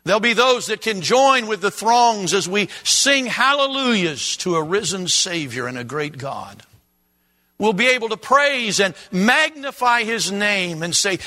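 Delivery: fervent, loud preaching. Acted. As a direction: preach it with full force. Preaching at full force.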